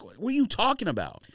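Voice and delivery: normal talking voice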